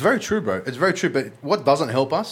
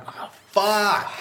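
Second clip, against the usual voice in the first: high, shocked oh no; sudden dismay; 9 semitones higher than usual. Retold or imitated. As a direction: caught out by the cop, alarmed. Realising it's an undercover cop.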